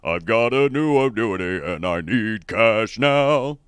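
lightly voiced commercial actor. Host quoting the annuity ad, mild ad-read voice. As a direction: read it like a cheesy TV ad. Doing the J G Wentworth ad.

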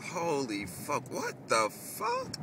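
slow, drawn-out, pitch leaping way up on fuck; a shocked slow-motion what the fuck; stretched-out incredulous outburst; stunned disbelief. Retold or imitated. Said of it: "You're like".

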